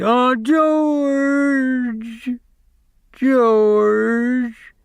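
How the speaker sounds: very high, loud drawn-out calls; crying out. A narrator's reading. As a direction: call for George in anguish. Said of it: "And he cried".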